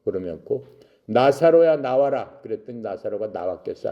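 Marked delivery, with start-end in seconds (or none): 1.1-2.4 loud, high summons; raised commanding shout, acted; calling out. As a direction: call someone forth from afar. Jesus calls Lazarus out.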